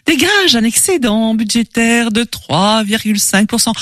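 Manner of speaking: usual speaking voice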